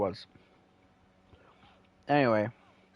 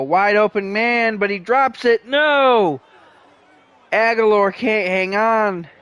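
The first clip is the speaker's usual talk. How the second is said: high, loud anguished call; excited dismay; 8 semitones higher, 7 dB louder, slower than usual. Real, not acted. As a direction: yell "No!" at the dropped pass. Dropped pass reaction.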